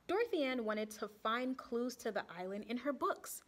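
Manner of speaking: ordinary delivery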